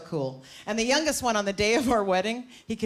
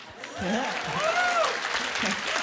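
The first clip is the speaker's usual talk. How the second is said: a sudden high squeal of laughter. Real, not acted. Comic squeals with laughter at her own pot joke.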